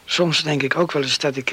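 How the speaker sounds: normal talking voice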